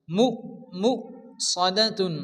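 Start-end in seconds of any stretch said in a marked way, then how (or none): 0.1-0.9 মু cut off abruptly, not stretched; jerked short. Shown as a demonstration. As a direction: give মু a quick jolt and stop. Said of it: "ঝটকা হবে".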